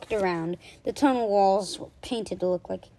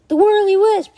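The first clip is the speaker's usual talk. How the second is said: loud, high cry of alarm; alarmed exclamation; 11 semitones higher, 12 dB louder than usual. A narrator's reading. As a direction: cry out at the sight of the monster. Monster is suddenly revealed.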